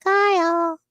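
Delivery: high, stretched silly call; silly put-on voice. Host retrying the great voice, high silly call. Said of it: "The problem with my voices".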